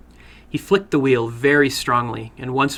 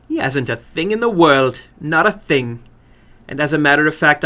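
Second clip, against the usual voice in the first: put-on grimly proud older woman; 3 semitones higher, 4 dB louder than usual. Woman voiced in story.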